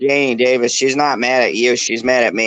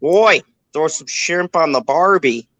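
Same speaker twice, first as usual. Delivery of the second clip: higher-pitched, hammed-up Aussie accent; bad Australian accent; 4 semitones higher than usual. Streamer attempting a comment in a bad Australian accent. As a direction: launch into a poor Australian accent off the first word. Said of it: "he can't do the accent unless he uses a starter word".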